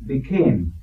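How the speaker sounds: "became" set apart with weight; stress on "became". Shown as a demonstration. Elder naming the key word of verse fourteen, stressed "became". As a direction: land deliberately on "became". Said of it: "the emphasis would seem to be on the word".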